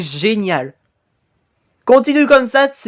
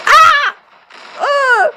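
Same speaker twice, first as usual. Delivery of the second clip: very high acted shrieks; terrified screams; 4 dB louder than usual. Victim struck by the vengeful ghost, high screams. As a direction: shriek twice in fright.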